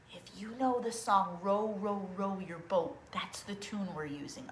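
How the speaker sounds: soft breathy whisper; whispered aside. Real, not acted. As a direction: drop to a whisper for the tip. Whispered aside about tune.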